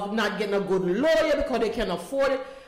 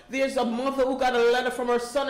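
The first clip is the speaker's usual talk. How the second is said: normal talking voice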